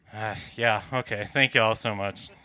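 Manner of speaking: ordinary delivery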